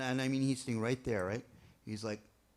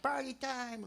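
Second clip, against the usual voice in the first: party time cheer; 11 semitones higher, slower than usual. Retold or imitated. Quebec crowd jumping up.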